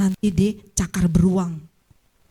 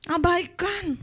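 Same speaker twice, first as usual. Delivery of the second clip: "Abaikan" said higher with a falling end; "Abaikan" pitched up and falling; higher repeat; 9 semitones higher, much slower than usual. Shown as a demonstration. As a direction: lift "Abaikan" high then drop it.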